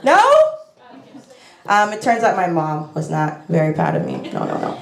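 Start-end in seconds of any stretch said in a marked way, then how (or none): 0.0-0.2 high-pitched, hopeful plea for approval; squeaky-high comic insistence; mock eager. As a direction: push the question way up, hammed-up eagerness. Keeps pressing mom for approval.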